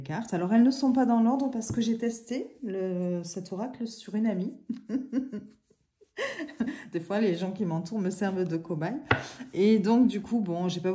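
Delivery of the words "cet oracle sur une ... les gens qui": laughing through the words. Real, not acted. Amused vlogger joking that friends serve as her guinea pigs, laughing. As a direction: chuckle it out, amused at yourself.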